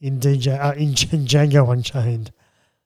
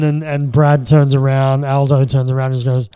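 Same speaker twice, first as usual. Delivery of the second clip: ordinary delivery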